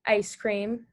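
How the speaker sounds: normal talking voice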